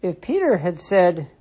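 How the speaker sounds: usual speaking voice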